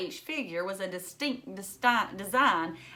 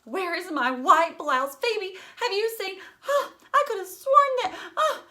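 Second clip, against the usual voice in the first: much higher and louder, flustered and near tears; rummaging in a panic, half laughing and half crying; frantic search; 12 semitones higher, 7 dB louder than usual. Acted. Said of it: "Prudence was in a frenzy".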